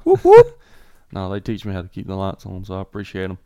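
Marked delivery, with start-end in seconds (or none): 0.0-0.6 loud, very high double whoop. Real, not acted. Co-host thanked for keeping the lights on, high whooping. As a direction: let out two piercing whoops.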